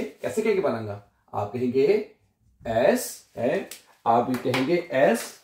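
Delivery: ordinary talk, nothing marked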